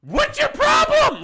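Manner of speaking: hammed-up yell at him; mock angry shout. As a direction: bar girls yelling at the owner, imitated shout. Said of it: "they were like".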